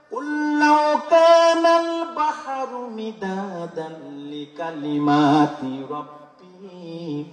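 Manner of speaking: very slow melodic tilawat; Quran recitation. Acted. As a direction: chant the verse slowly, drawn out.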